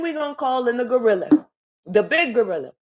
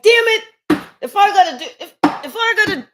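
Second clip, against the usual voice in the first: sudden high, loud cursing burst; an exasperated yell of damn it; frustrated outburst; 8 semitones higher, 7 dB louder than usual. Retold or imitated. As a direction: blow up at the plan that failed. Frustrated person cursing at failure.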